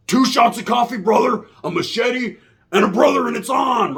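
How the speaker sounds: high and loud, pumped for a fight; a convict hamming up his plan for the yard; hyped-up threat. Retold or imitated. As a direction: bark it like a man itching to go off. War's hyped threat voiced.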